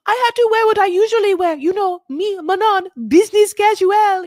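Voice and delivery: high, loud French accent, hammed-up; mock French accent. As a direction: camp it up as Manon in a French accent. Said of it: "My French accent is".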